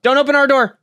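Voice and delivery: high, loud holler; called-out warning. Real, not acted. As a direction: shout it toward the front door. Calling warning toward the door.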